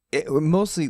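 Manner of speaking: usual speaking voice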